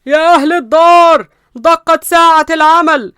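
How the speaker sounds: loud, drawn-out wake-up call; booming high shout, words stretched out; yelling. Acted. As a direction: bellow it like a town crier, high and slow. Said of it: "واحد بيزعق وبيقول".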